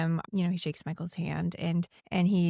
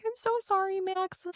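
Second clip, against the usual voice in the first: very high, frantic apologetic mother; flustered mom; 13 semitones higher, 3 dB quieter than usual. Retold or imitated. Lucy apologizing to Max for Sam, very high flustered. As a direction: squeak out embarrassed apologies.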